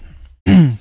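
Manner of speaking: loud sharp throat clear; a loud real throat clear. Real, not acted. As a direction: clear the throat hard before going on. Loud throat clear mid-story.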